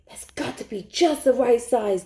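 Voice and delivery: ordinary delivery, nothing marked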